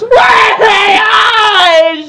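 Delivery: very high, very slow wailing sob; crying out. Acted. Fluttershy breaking into tears and wailing, loud, high and very slow. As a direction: wail it out like a hammed-up cartoon sob. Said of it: "broke into tears".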